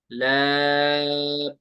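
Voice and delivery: ला held long on one pitch; three-alif elongation. Shown as a demonstration. Teacher repeating the correct recitation with long ला. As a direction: stretch ला for three counts. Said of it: "ऐसे मैं पढ़ूंगा".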